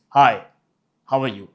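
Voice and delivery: firm, punched deep greeting; deep, authoritative. Shown as a demonstration. Coach showing tone difference, deep and authoritative. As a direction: land a loud, commanding hi. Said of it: "the tone in the first one was authoritative".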